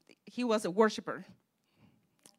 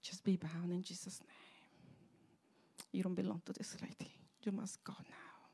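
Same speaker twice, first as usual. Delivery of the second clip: breathy retold whispered command; 11 dB quieter than usual. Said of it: "I would just whisper to her".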